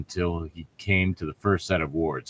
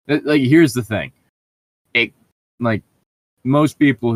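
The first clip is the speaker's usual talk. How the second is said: louder and higher, revving up; emphatic complaint; 5 semitones higher, 6 dB louder than usual. Real, not acted. Co-host about to rant about the writer, emphatic. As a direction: start the rant with force.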